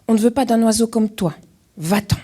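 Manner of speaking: loud, fast dismissive shout at the stranger; rejecting shout. Acted. Animals shouting at Alfred to go away, loud and fast. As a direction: chase him off, loud.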